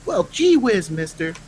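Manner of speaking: put-on high gee-whiz kid voice; wholesome 1950s kid. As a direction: chirp it like a newsboy. Old-timey kid exclamation.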